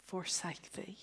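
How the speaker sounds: whispered end of God's promise. Acted. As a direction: let the promise fall to a whisper.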